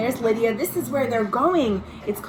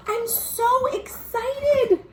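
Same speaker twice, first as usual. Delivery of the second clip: much higher, slow, gushing; excited; 12 semitones higher, much slower than usual. Acted. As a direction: let the joy spill out slowly. Lydia's letter, excited news.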